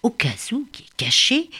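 usual speaking voice

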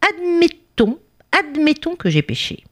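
high, small voice arguing back; plucky little bee. Acted. Bee regaining courage before the king, plucky retort. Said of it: "reprenant son courage".

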